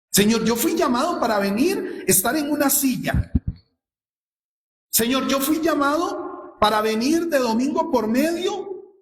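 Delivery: lightly lampoon a lazy believer; mock excuse.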